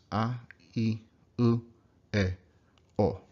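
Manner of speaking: a, I, u, e, o each short and pure, no glide; crisp single vowels with no glide; short Japanese vowels. Shown as a demonstration. Teacher showing short pure vowels to students. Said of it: "Really short".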